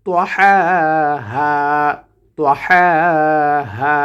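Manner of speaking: তাহাহা with the final vowel drawn out slowly; one alif stretch. Shown as a demonstration. Teacher modelling the vowel length on তাহাহা. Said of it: "একালিপ করে টান হবে".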